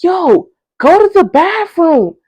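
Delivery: high, slow, loud urging; urgent group shout. Retold or imitated. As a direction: yell it at a sick friend. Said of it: "everybody was like".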